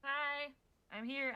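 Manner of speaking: ordinary talk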